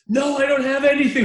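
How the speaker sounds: loud, high-pitched mock outburst; angry. Priest refusing a homeless man money, angrily. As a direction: snap it back, loud and high. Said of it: "angrily, I might say, not in a very calm".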